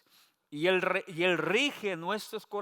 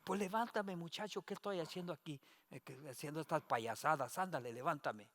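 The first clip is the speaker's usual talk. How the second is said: scolding mother, softer; 3 semitones lower, 12 dB quieter than usual. Retold or imitated.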